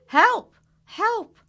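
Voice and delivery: very high, loud cry for help; shrieking for help. Acted. The animals cry loudly for help from the tree. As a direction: scream for help.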